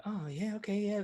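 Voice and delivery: high, soft mock agreeing doctors. Mimicking the doctors agreeing with the kid, high and soft. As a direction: chirp it like a breezy TV doctor. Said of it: "they're like".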